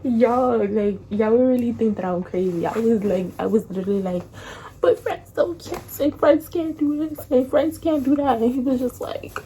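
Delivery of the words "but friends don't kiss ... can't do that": high-pitched pleading whine; exaggerated high whimpering protest; mock whining protest. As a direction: plead it in a whiny, pouty voice. Re-enacts tearful protest at breakup.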